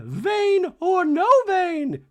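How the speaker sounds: very high, loud, drawn-out mock declaration; Dorit declaring. Dorit refusing in a talking head, slow shrieked defiance. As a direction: stretch it into a hammed-up shriek. Dorit acted, host repeats it plainly.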